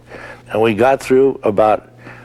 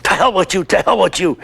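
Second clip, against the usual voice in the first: imitating the director's outburst, higher, without real volume; retold angry yell; 7 semitones higher, faster than usual. The director yelling at the actor, mock angry yell. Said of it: "And he says".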